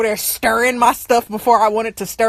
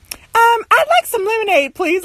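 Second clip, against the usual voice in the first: put-on high, affected old-parent request; mock-sweet sassy imitation; 10 semitones higher than usual. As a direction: imitate a parent asking, pitched way up. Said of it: "they become real sassy".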